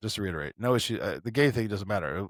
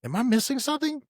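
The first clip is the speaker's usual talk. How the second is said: mock disbelief; 7 semitones higher than usual. Mock incredulous retort to Dash.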